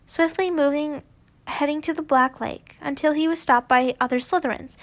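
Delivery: plain reading voice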